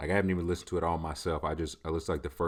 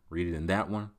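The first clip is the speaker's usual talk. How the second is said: ordinary talk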